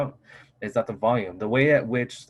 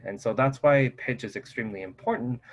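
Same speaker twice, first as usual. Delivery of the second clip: ordinary talk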